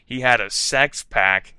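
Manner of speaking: ordinary delivery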